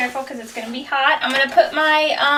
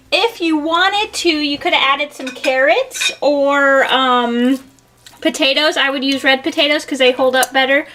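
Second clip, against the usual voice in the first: usual speaking voice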